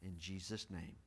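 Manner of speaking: soft, low, hushed prayer. Real, not acted. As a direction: close the prayer quietly. Closing the opening prayer.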